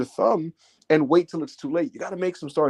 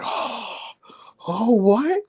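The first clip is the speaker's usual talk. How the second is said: crowd gasp; 6 semitones higher, much slower than usual. Retold or imitated. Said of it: "the crowd is like".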